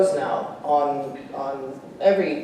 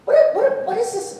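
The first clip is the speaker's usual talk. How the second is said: imitating Jay Leno. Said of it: "he made fun of me".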